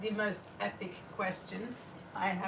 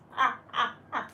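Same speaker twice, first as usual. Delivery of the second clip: real breathless laughing fit; dying laughing; 3 dB louder than usual. Real, not acted. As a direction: burst into helpless giggles. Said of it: "I'm dying".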